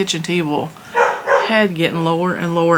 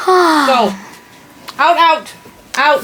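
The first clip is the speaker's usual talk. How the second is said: shooing dogs; 7 semitones higher, much slower than usual. Real, not acted.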